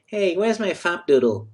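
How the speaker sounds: high put-on kid's voice; childlike voice. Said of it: "like children's insult".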